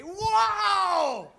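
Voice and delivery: high, loud, slow shout; shouting to the theatre mid-film; yelled. Pretend. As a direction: bellow it over the movie. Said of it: "you say".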